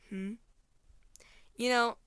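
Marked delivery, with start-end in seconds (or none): none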